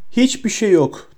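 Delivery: high-pitched, urgent shout. A narrator's reading. Harry tells Bellatrix the prophecy is gone, shouting. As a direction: cry it out high, moderate volume. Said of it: "diye bağırdı".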